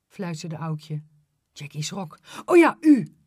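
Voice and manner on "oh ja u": high, loud startled correction; startled child, loud and high. Acted. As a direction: catch yourself in a fright.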